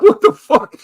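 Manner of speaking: high, loud laughing what the fuck; laughing squeal. Real, not acted.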